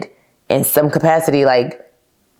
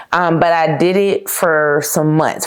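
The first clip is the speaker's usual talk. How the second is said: ordinary delivery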